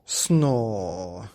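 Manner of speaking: mock snore. Narrator voicing Mummy Bear snoring, mock snore. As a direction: snore it out loud. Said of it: "went Missus Bear".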